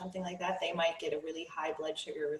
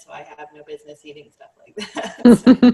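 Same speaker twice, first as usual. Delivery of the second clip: breaks into laughter, much louder; laughing; 21 dB louder than usual. Real, not acted. To the host, laughing about having no business eating pineapple. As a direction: laugh through the punchline.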